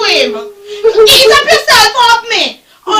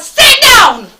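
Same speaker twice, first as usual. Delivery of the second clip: normal talking voice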